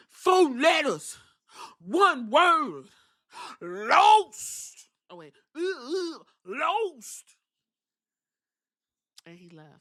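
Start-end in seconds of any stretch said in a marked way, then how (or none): 0.1-7.2 mock dramatic promo. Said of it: "mock dramatic promo".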